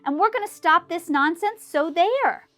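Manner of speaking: high, loud monkey jeers; jeering shout. A narrator's reading. Wickersham brothers shouting at Horton, high and loud. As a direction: taunt him loudly. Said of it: "came shouting".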